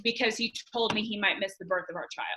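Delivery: hushed, intimate whisper. Real, not acted. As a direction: drop to a soft whisper. Hushed turn to the key moment.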